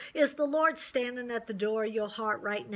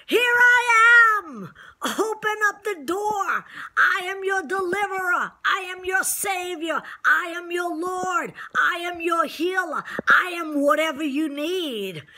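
much higher, loud, impassioned cry; Jesus crying out; 7 semitones higher, 6 dB louder than usual. Acted. Preacher voicing Jesus to the congregation, crying out loud. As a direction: cry out to the listener at full force, pleading. Voices Jesus, climax of sermon.

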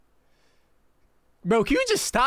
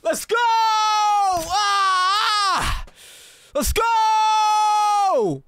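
high, loud victory screams; ecstatic screaming; 7 semitones higher, 4 dB louder, much slower than usual. Real, not acted. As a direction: scream with joy at the milestone. Hitting a hundred thousand subscribers live.

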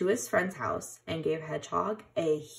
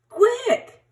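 urgent shout; 9 dB louder than usual. A narrator's reading. Animals fleeing the rain.

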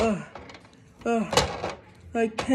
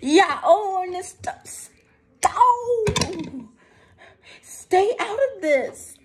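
high angry shout; 11 semitones higher, 3 dB louder than usual. Pretend. Character shouts at interferer.

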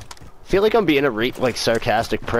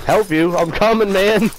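high, hammed-up rush to help; played-up eagerness; 7 semitones higher than usual. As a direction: play up coming to the rescue. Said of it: "I gotta play it up".

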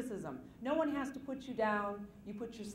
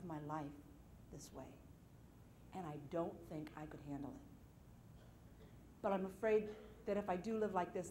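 hushed fearful confession; 3 semitones lower, 6 dB quieter than usual. Retold or imitated. Telling her husband her fears, soft and low. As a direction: confide the fear quietly.

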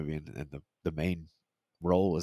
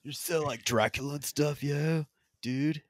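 slow, higher mock surfer voice; hammed-up slacker bro, dragging the words; dude-bro impression; 7 semitones higher, 4 dB quieter, slower than usual. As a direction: put on a spaced-out dude voice. Joins in the Keanu dude impression.

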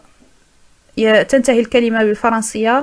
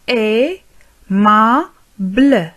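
"Aimable" split slowly as ai, ma, ble; very slow "Aimable" with pauses between syllables; much slower than usual. Shown as a demonstration. Teacher modelling for learners, syllable by syllable "Aimable".

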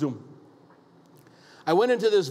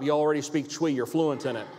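normal talking voice

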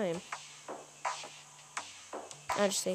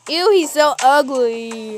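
loud, slow put-on "Ew"; loud slow disgusted jeer; grossed-out; 2 semitones higher, 9 dB louder, slower than usual. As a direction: drag it out in disgust. Mocking a character's looks.

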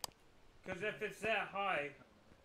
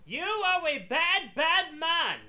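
slow, high, very loud scolding; taunting shout; 9 semitones higher, 12 dB louder, much slower than usual. Pretend. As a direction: scold the ghost at full volume, word by word. Taunting the ghost to provoke it.

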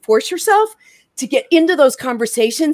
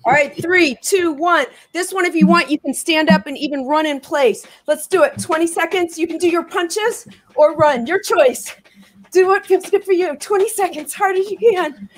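higher, energetic trainer patter; upbeat coaching; 7 semitones higher than usual. Acted. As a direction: drive the class on. Second round coaching.

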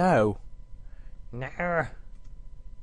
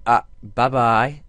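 normal talking voice

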